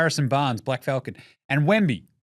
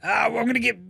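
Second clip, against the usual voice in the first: high frantic excited rant; excited Presti; 8 semitones higher than usual. Retold or imitated. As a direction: squeal it out, giddy and high. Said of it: "He's like".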